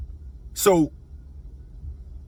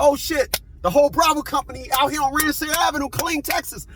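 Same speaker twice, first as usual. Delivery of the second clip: higher, excited recall of spotting the crowd; mock amazement, pitched up; excited exclaim; 8 semitones higher than usual. Spotting the whole company on the avenue, excited. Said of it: "I said".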